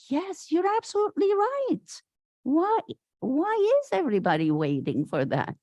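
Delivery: suddenly convinced, agree with a rush; eager agreement. Retold or imitated.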